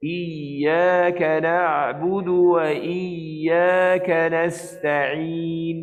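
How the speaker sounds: slow chant, tongue middle near palate on "iyyaka"; smooth tajwid chant of "iyyaka"; correct recitation. Shown as a demonstration. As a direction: recite "iyyaka" with the ya gliding without touching. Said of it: "Dia cuma menghampiri saja".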